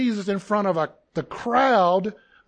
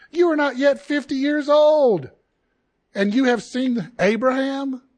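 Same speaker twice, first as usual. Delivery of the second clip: higher, louder scoffing disbelief; incredulous outrage; 6 semitones higher, 4 dB louder than usual. Acted. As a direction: throw the question back in mocking anger. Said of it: "two people having a disagreement that was intense".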